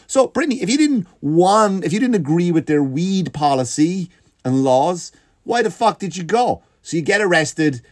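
high, incredulous scolding; exasperated. Real, not acted. Comedian scolding Brittany for going, high and exasperated. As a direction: lecture her in disbelief.

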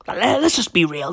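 whispered aside; hushed, unvoiced aside to the crowd. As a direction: confide it in a whisper.

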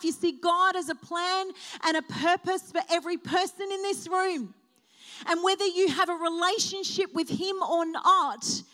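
loud, rising, impassioned preaching; preaching at full force. Acted. Pastor calling the Easter congregation to remember God, fervent preaching. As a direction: stir the congregation.

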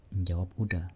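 ordinary delivery

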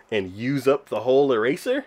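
ordinary talk, nothing marked

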